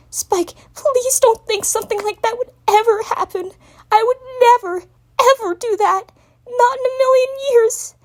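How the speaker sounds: high, tearful but steady; reassuring. Acted. Twilight reassuring her crying assistant. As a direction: reassure him through your own tears. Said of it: "speak reassuringly".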